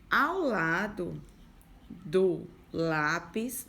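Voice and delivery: each word drawn out slowly; unhurried teacher pacing. Shown as a demonstration. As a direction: spell it out slowly for children. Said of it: "teacher drawing out words".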